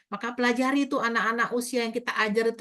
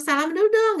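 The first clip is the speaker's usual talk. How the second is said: higher, louder cheerful coaxing; teacher coaxing child; 10 semitones higher, 7 dB louder than usual. Retold or imitated. Teacher asks child to greet her, bright coaxing. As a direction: invite the kid to shake hands brightly.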